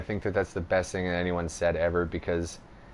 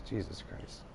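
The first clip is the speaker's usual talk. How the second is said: whispered; 17 dB quieter than usual. Real, not acted. Exasperated aside.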